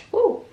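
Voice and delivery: sudden high, loud woo; quick high-pitched whoop. Real, not acted. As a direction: let out a short loud whoop. Exclaims mid-story.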